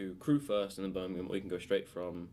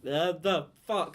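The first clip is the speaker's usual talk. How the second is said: tongue-tied frustration; 7 semitones higher, 7 dB louder, much slower than usual. Real, not acted. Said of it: "can't speak".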